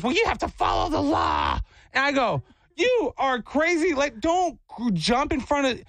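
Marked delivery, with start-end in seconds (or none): 0.0-1.6 screechy mock yell; mock scolding lady. Lady lectures cyclist about the law, screechy mock scolding. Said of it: "And she goes". 2.7-5.8 high-pitched, indignant telling-off; exasperated retort. Retold or imitated. Cyclist to lady who jumped in front, exasperated.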